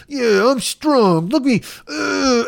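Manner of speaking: put-on high, slow little kid showing off; little kid flexing. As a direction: play a small boy flexing like Hulk Hogan. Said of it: "a little kid who do Hulk Hogan".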